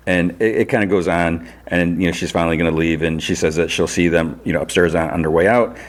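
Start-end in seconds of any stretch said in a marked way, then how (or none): none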